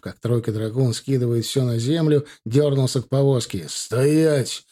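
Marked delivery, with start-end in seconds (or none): none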